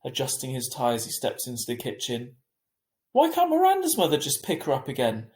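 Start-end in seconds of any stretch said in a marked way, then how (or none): 3.1-5.3 arguing. A narrator's reading. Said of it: "Mum argued with him".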